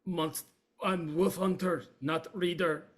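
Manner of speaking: a proud wolf hunter protesting; gruff Yevgeny. Acted. Yevgeny defending himself.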